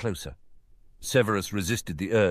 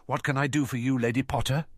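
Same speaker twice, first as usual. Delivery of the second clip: tired; 7 semitones higher than usual. Acted. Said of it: "he said tiredly".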